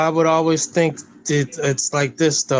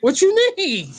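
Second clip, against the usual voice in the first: very high put-on squeak; mock eager pleading. Playing a company begging boycotters back, mock pleading. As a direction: pitch it way up, playfully imitating someone begging.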